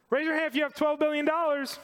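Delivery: playful challenge thrown out to the congregation; rousing challenge. Real, not acted. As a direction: dare the crowd to raise hands.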